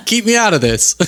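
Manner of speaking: high, laughing Jesus voice; lightly comic high-voiced Jesus; Jesus impression. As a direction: play Jesus begging off, amused. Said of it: "What's my Jesus impression?".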